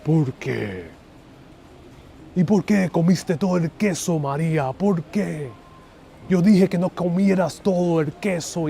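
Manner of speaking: melodramatic Spanish soap-opera lament; Telemundo impression. Lover confronting María over the eaten cheese, telenovela melodrama. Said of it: "That's my, uh, best, uh, Telemundo, Telemundo impression".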